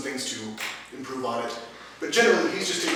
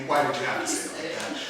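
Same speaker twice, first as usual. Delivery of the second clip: lower, laughing mock gripe about wordiness; a lighter chuckling echo of the gripe; laughing retold complaint; 4 semitones lower, 4 dB quieter than usual. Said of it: "the German is equally".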